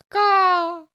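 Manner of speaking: loud high caw; bird caw. Retold or imitated. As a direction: squawk like a mocking bird. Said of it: "and go".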